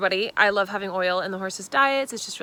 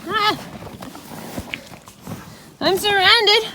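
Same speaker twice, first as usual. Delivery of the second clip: surprised yelp; 11 semitones higher, 3 dB louder than usual. Real, not acted. Ponies crowding for carrots.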